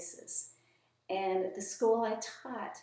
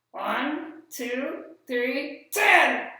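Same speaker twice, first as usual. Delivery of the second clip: higher, louder puppet, triumphant at the end; proud puppet; 5 semitones higher, 8 dB louder, much slower than usual. Acted. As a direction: count proudly and get it wrong. Puppet counts wrong.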